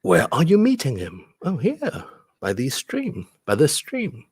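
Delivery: animated, high-swooping owl exclamations; story reading. Acted. Teacher reading the owl's scared exit, animated high-pitched storytelling. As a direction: build to a high startled hoot, then drop. Reads the picture book aloud.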